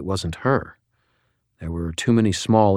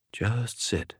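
mumbling; 4 semitones higher, 10 dB quieter than usual. A narrator's reading. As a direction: mumble it to yourself. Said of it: "she mumbled".

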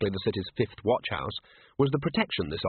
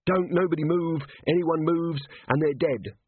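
lightly voiced robber, pitched higher; a mildly raised hold-up threat; threatening robber; 6 semitones higher than usual. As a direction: order the room to freeze, higher-pitched. Armed robbers burst into pub.

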